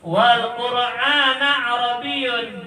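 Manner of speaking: slow, high Quranic recitation; recited Arabic verse. Acted. Preacher to congregation, reciting the Arabic verse slowly.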